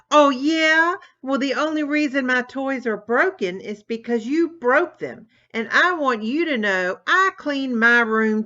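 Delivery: an enraged brother shrieking back at his sister; angry shriek. Acted. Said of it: "shrieked Tommy".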